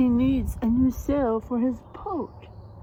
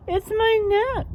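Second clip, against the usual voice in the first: high, louder giraffe voice; sad giraffe; 12 semitones higher, 5 dB louder than usual. Acted. Giraffe explains its cold neck, high and plaintive. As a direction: complain as a cold little giraffe. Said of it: "said the giraffe".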